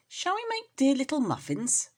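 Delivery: a small kitten coaxing her sister; light high kitten; kitten Mittens' voice. A narrator's reading. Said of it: "said Mittens to Moppet".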